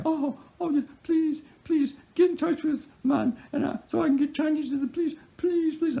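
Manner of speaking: very high falsetto imitation of a begging woman; mock crying plea. Buyer phones the seller begging for help, mock tearful falsetto. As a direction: whimper and beg in a put-on woman's voice. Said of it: "it's like crying".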